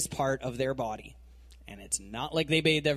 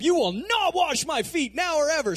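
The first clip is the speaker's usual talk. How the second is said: higher, louder, shouted refusal; worked-up shout; 10 semitones higher, 5 dB louder than usual. Retold or imitated. Peter yells his refusal, high and loud. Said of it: "he's all worked up".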